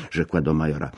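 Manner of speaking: plain reading voice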